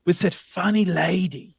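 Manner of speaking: normal talking voice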